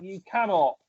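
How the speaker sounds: ordinary talk, nothing marked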